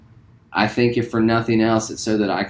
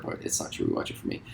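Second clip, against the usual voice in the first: whisper; 13 dB quieter, much faster than usual. Acted. Confessing a secret aside.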